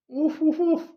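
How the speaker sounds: high-pitched little woofs; quick high mock barking; imitated dog bark. Imitating the stuffed dog's bark.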